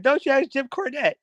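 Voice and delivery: high-pitched imitation of a ranting wrestling pundit; Jim Cornette. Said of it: "the funniest Jim Cornette rant".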